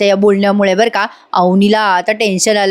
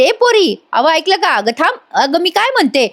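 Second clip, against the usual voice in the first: put-on high-pitched calling after the girl; nosy aunt chasing someone, calling higher; 6 semitones higher than usual. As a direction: call after her like a pushy village aunt.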